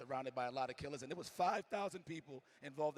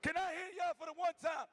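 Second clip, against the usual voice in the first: high, loud call to the crowd; crowd hype shout; 4 dB louder than usual. Real, not acted. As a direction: pump up the arena. Hyping up the crowd.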